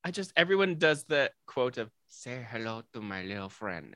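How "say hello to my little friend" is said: lower, softer hammed-up gangster line; common Scarface misquote. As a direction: mimic how everyone quotes it. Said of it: "they say it like that".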